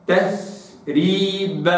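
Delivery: تثريب drawn out very slowly, clear th; slow recitation. Shown as a demonstration. Teacher reciting the verse word slowly. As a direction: stretch تثريب with a crisp ث. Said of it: "هكذا".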